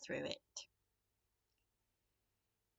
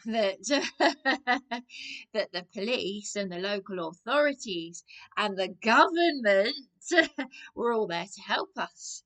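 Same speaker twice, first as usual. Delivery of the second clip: bitter laugh; 8 dB louder than usual. Real, not acted. Laughing at his former naivety.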